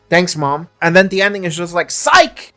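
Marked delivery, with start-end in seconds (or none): 1.9-2.5 high, playful "psych!"; spring the twist like a prank; mock twist. Mock twist ending reveal.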